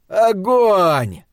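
high, loud, drawn-out firing order; shouted command. A narrator's reading. Kearns ordering riflemen to shoot over the roar, shouted. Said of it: "крикнул Кернс, перекрывая треск огня".

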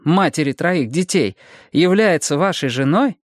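high-pitched, insistent detective; detective Bonnie, firm. Acted.

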